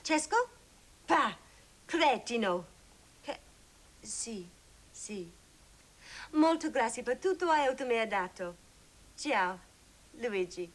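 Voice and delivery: halting tourist Italian; broken Italian. Acted. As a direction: speak in clumsy phrase-book Italian. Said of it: "according to my phrase book".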